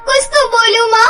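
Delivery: high, loud, tearful cries for mother; crying shout. Acted. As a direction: call out to mother in panic, sobbing. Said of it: "जोर से चिल्लाकर कहती है".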